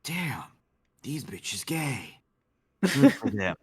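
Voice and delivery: lightly voiced action-hero quip; drawn-out, tongue-in-cheek macho praise; Duke Nukem. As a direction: play it as a mock action hero. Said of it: "edit of Duke Nukem and be like".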